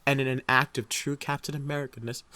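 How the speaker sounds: ordinary delivery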